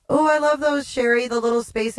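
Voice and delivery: gasp, then high delighted squeal; light up with delight; excited. Real, not acted. Gasps at a space-invader gift.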